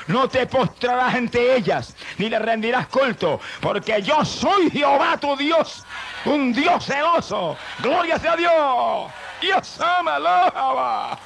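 high, loud, slow thundering sermon cry; preaching at full force. Acted. Preacher thundering the commandment to the congregation, high and loud. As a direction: thunder God's warning out to the church.

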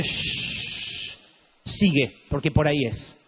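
God gently hushing his servant; voz de Dios. Retold or imitated. (voz de Dios, chistido suave y orden serena más grave)

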